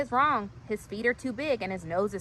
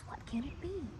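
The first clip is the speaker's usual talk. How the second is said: soft, musing half-voice; thinking to himself; 5 semitones higher, 11 dB quieter than usual. Acted. Marvin ponders the mystery, soft and musing. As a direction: murmur it to yourself. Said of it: "scratches his chin and thinks".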